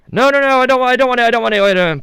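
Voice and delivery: put-on high, loud old lady refusing the caller; flustered nan. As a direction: play the confused grandmother cutting off a salesman.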